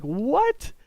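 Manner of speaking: high, rising what; mock disbelief; baffled. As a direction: squeak out a stunned what. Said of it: "I look at him, I'm like".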